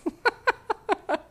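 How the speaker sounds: high giggling laugh; giggling at the clown image; real laugh. Real, not acted. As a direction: laugh at your own joke.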